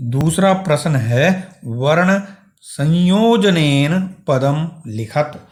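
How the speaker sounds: usual speaking voice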